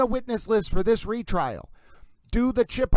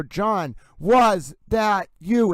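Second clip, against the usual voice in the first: WAS pushed louder and stretched long; inflection on WAS; 5 dB louder, much slower than usual. Shown as a demonstration. Host showing the voicemail's inflection, stress on WAS. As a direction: punch WAS, then slow "that you". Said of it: "there's an inflection on the".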